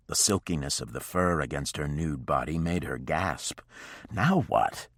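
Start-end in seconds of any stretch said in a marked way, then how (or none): 4.1-4.9 a touch of impatience; impatient. A narrator's reading. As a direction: ask it with short patience. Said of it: "Wolf asked impatiently".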